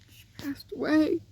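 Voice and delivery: barely voiced, breath only; broken whisper. Real, not acted. Mother of the puppy telling viewers she died, in a grieving whisper. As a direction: whisper the news, grief.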